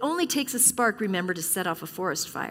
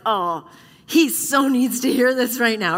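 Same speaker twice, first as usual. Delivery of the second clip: smug elbow nudge; 2 semitones higher, 7 dB louder than usual. Retold or imitated. Mocking a churchgoer elbowing a neighbour.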